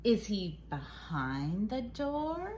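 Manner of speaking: ordinary talk, nothing marked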